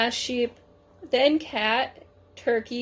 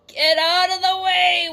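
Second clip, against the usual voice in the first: higher, louder honking shout; horn shout; 7 semitones higher, 6 dB louder than usual. A narrator's reading. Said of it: "which shouts".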